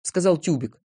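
usual speaking voice

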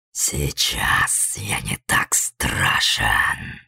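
deep whispered guttural monster. A narrator's reading. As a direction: growl it from deep in the throat. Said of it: "низкий утробный рык".

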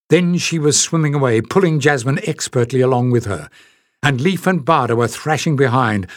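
ordinary delivery, nothing marked

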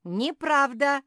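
very high, loud, rising; stubborn shouted denial. A narrator's reading. Squirrel standing his ground, stubborn shout. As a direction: dig in and shout it back. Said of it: "стоял на своём Бельчонке".

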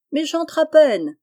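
high, loud, slow playful call from the water; crying out. A narrator's reading. Wife in the sea calls to her husband, loud and high. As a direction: call out to him over the waves. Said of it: "cria la jeune femme".

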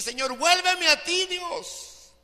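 a repentant believer crying out to God; pleading prayer. Acted. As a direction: beg God to take you back. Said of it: "clames y gimas a Dios y dile".

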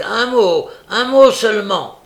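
loud, high plea shouted after someone; urgent calling. A narrator's reading. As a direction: cry out for a moment's hearing. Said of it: "cria le Juif en courant après lui".

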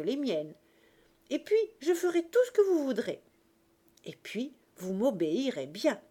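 eager, animated chatter with swooping pitch; lively Cosette, cheerful. Acted. Cosette planning grandfather's life with them, lively and eager. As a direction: tumble from little sorrow into bossy delight.

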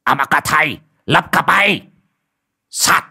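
forceful exorcist's bellow; shouted incantation. A narrator's reading. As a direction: bark out the spell in short bursts. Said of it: "quát lên một tiếng".

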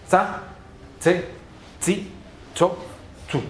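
"tsa, tse, tsi, tso, tsu" with a crisp ts, high; a crisp ts on "tsa, tse, tsi, tso, tsu", one by one; Esperanto C syllables. Shown as a demonstration. Teacher drilling the Esperanto C syllables. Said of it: "lo que hay es".

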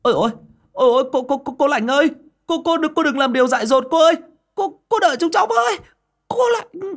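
high, loud, fast stammering cries; frantic calling out. Acted. Boys running calling widow, frantic tearful cries. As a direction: call after her in tearful panic. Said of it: "vừa gọi với".

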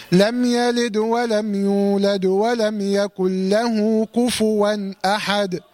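slow chant running on, "يولد" kept vowelless with a bounced dal; qalqala while joining. Shown as a demonstration. Teacher modelling joined recitation, chanting "يولد" with echoed still dal. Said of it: "لازم أقلقل الدال سواء وصلت".

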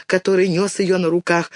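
ordinary delivery

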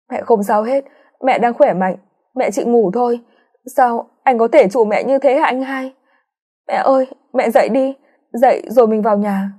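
put-on high, pleading girl's voice in denial; a young daughter begging her mother to wake up; grieving daughter. A narrator's reading. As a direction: daughter refusing to accept mom's death, high and pleading. Daughter denies mother's death.

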